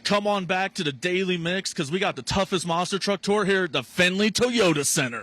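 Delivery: loud, high hype announcer; fighting game announcer. Shown as a demonstration. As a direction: pump up the arena crowd. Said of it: "give me your best announcer voice".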